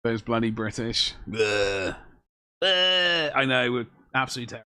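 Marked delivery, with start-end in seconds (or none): 1.3-3.3 drawn-out "bleh" noise; mock disgusted bleh. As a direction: groan a long, playful bleh.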